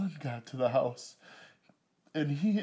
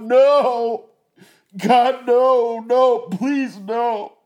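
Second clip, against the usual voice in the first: very high, very loud desperate pleading; anguished crying out; 11 semitones higher, 17 dB louder, much slower than usual. Retold or imitated. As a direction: scream no at the top of your voice, begging. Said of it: "I'm crying out".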